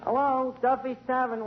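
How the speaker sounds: ordinary talk, nothing marked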